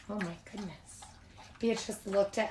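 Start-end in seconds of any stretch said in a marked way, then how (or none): 0.1-1.0 very soft murmur; quiet aside. Real, not acted. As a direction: whisper it aside. Aside, reacting.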